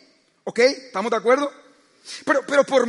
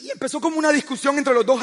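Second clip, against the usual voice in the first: ordinary delivery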